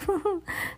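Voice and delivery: shrill evil witch cackle; high witch cackle. A narrator's reading.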